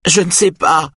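high-pitched desperate cry; cried out. A narrator's reading. Aïda under questioning by Simone, crying out. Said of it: "crie Aïda".

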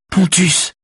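high, desperate woman's cry; acted frantic plea; imploring. A narrator's reading. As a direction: beg him at a very high pitch. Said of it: "l'implore Véronique".

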